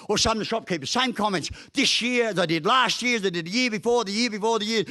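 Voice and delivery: usual speaking voice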